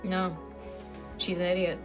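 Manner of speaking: ordinary delivery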